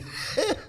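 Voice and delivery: high burst of laughter; real high-pitched laugh; amused laugh. Real, not acted. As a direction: break into a laugh mid-thought. Laughing over remembered classes.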